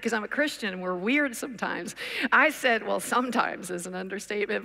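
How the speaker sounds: ordinary talk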